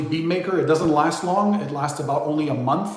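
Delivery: usual speaking voice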